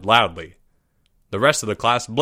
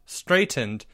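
ordinary delivery

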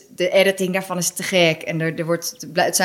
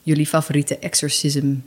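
ordinary talk, nothing marked

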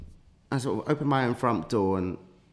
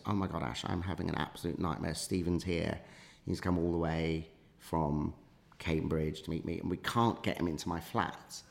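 lower, softer retold panic; hushed urgent confiding; 3 semitones lower, 7 dB quieter than usual. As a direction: confide a crisis under your breath. Lily confides panic down the corridor.

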